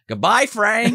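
gloating goodbye to the floating heckler; mock farewell. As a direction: wave him off cheerfully.

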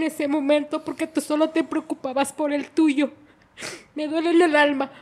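loud, high, anguished reproach; re-enacting a daughter's crying accusation at full pitch; retold tearful outburst. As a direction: pour out hurt at a mother, high and loud. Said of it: "llorando, me dijo".

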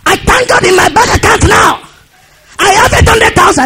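high, shouted imitation of a boastful testimony; mock triumphant announcement; boasting minister. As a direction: crow it out high like a bragging preacher.

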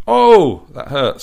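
sudden high yelp of pain, loud; scream of pain. Acted. Michael yelps as Doris presses his wound, loud pained scream. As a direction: shriek it out as it hurts. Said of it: "screamed Michael".

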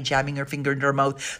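ordinary delivery

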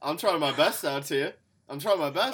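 pleading, broad fake Aussie; bad Australian accent. Contestant defends his effort to the host, hammy Australian accent. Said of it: "worst Australian accent".

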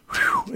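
high exhaled whew. Real, not acted. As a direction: blow out a long whew. Exhale before recalling loss.

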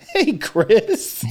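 put-on high little-boy greeting; high-pitched small child; little kid. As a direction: imitate a four-year-old calling out. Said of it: "little dude says".

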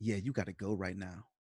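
low, hushed menace with long pauses; deathly quiet. Retold or imitated. Mike orders the interviewer out, imitated deathly quiet. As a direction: drop it right down and leave gaps between phrases. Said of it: "he gets deathly, like, quiet".

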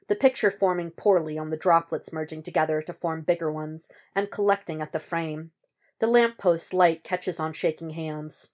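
ordinary delivery, nothing marked

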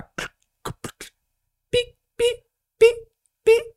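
microwave beeps. Acted. Imitating the microwave beeping.

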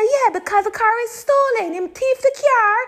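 very high, ranting mom in Jamaican Patois; Jamaican Patois mother. Retold or imitated. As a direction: rattle off mom's indignation in Jamaican Patois. Imitating mother's patois rant.

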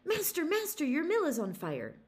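high, loud cry of alarm; mock alarm shout; shouted warning. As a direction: call it out like the mill is burning. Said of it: "shout out".

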